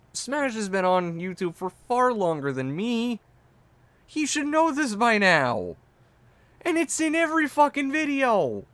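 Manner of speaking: exaggerated rant. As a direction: ham up the outrage, rising. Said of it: "playing my reaction up".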